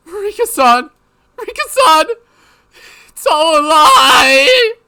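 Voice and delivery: high, loud, slow crying shout; anguished wail. Acted. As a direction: wail in denial. Mion weeping in denial.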